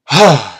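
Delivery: loud sigh of relief; heavy sigh. Real, not acted. Gamer calming down after raging, heavy sigh. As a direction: let out a big exasperated breath.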